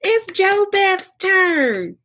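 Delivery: high, slow, gleeful cry of a crowd of spirits; excited spirits. Retold or imitated. Spirits eagerly announcing Jo Beth's turn, in a high, slow, excited voice. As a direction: sing it out like eager voices calling her turn. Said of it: "they were like".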